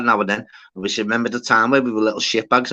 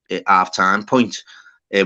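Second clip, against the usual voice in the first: ordinary delivery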